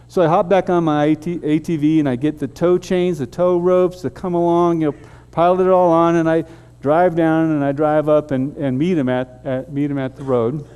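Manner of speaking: usual speaking voice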